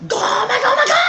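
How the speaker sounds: high rising battle yell; Luffy attack shout. Pretend. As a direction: scream the gomu gomu attack.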